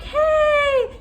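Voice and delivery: sudden high shout, imitating the patient; retold yell. Nurse retelling the patient's sudden high yell. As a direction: bark it out high and loud like a startled man. Patient yells while peeing.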